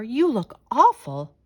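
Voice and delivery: put-on a mother fussing over a messy child; mock-shocked mother. Mother scolds dirty daughter.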